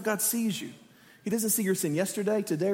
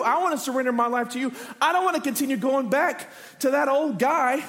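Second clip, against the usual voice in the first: impassioned preacher's prayer, higher and building; fervent prayer; 3 semitones higher than usual. Acted. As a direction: plead to God at full preaching force. Acts out fervent prayer of surrender.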